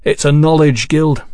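ordinary delivery, nothing marked

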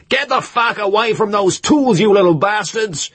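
high, loud furious shout; angry yelling. A narrator's reading. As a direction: bellow at the boys to back off. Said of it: "was yelling at the boys".

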